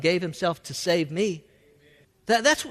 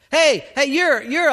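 higher, louder call to get attention; accusing bystander; 5 semitones higher, 5 dB louder than usual. Acted. Bystander spotting a follower of Jesus, calling out accusingly. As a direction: call out, halting as recognition dawns.

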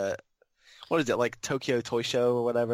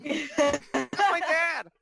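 very high, loud, drawn-out yell; gleeful imitation of a man yelling; echoed shouting; 5 dB louder, much slower than usual. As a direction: echo the clip's shout, stretching it out. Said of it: "where he's just shouting".

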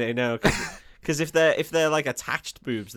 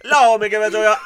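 loud, higher mock Jamaican patois; mock Jamaican accent; 4 semitones higher, 9 dB louder than usual. Teasing the co-host's Hook, in loud mock Jamaican. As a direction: belt out a hammed-up Jamaican accent. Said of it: "Why is he [name]?".

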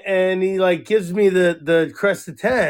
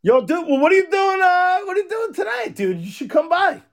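high, eager call to a buddy; excited invitation; 11 semitones higher than usual. Real, not acted. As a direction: pitch it way up, urging a friend over. Eagerly invites friend over for dinner.

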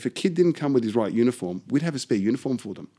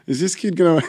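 nervous laugh while speaking; laughing off the nerves of that moment; 7 semitones higher, 8 dB louder than usual. Real, not acted. Laughing nervously while recalling the standoff with Omar.

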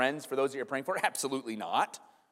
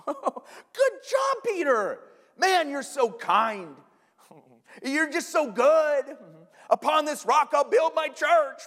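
very high, drawn-out sugary praise; hammed-up flattery with chuckles; lavish fake praise, pitched way up; mock-gushing Jesus; 11 semitones higher, 5 dB louder, much slower than usual. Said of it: "Jesus is like".

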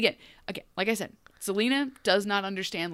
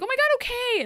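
high, swooning giddy acceptance; swooning yes; 3 dB louder, faster than usual. Retold or imitated. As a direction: squeal it like a smitten girl. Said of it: "she's like".

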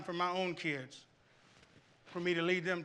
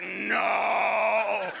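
playful shout; 3 semitones higher, 7 dB louder than usual. Retold or imitated. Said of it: "I was like".

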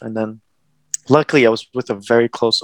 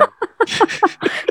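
voiceless laugh under the breath; silent laugh. Real, not acted. Laughing at dad's last words.

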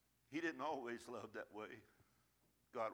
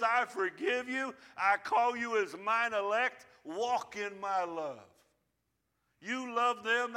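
full-force preaching; 6 semitones higher, 9 dB louder than usual. Acted. Pastor proclaiming God's forgiveness, high and loud. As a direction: declare forgiveness with power.

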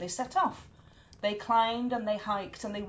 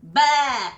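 goat bleat; 3 semitones higher, 9 dB louder than usual. Acted. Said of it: "went the goat".

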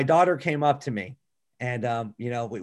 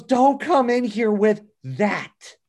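put-on higher, slow, upset child's voice; tearful refusal; 8 semitones higher, 4 dB louder, slower than usual. Quoting his bawling daughter keeping him out, tearful. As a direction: plead like a crying little girl.

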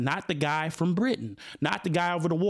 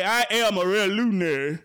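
mocking the actor; 3 semitones higher, 4 dB louder than usual. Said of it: "allow someone to say".

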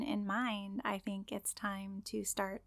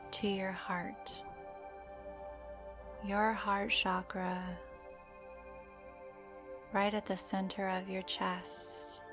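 guided meditation; slower than usual. Acted. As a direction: gently guide the listener into calm.

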